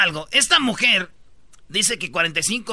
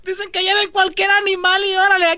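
mock angry aunt; 11 semitones higher than usual. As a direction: scold him like a furious wife. Angry aunt scolding uncle.

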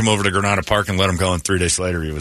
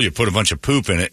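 ordinary talk, nothing marked

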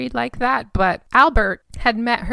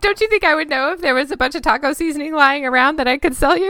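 exasperated; 6 semitones higher, 5 dB louder, faster than usual. Retold or imitated. Imagined exasperated reply.

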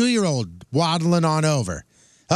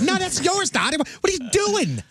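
high-pitched acted protest; hammed-up disbelief at being handed it back; mock indignant; 8 semitones higher than usual. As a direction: act out exasperated refusal, pitch climbing high. Acts out protesting Mom returning ice cream.

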